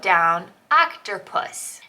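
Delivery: "octopus" drawn out slowly, syllable by syllable; slow spelled reading. Shown as a demonstration. Writing in her journal, slowly sounding out "octopus". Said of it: "octer for octopus".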